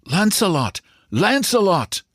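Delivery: put-on high cry in a French accent. A narrator's reading. Excited racegoer crying the horse's name in French accent. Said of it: "in his French accent".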